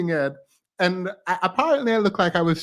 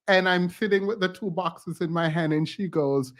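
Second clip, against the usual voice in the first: usual speaking voice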